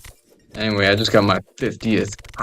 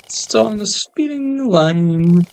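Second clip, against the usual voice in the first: lightly voiced goofy character, higher; a hint of a high cartoon voice; mock silly voice; 11 semitones higher, slower than usual. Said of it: "He goes".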